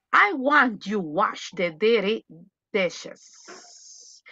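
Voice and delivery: usual speaking voice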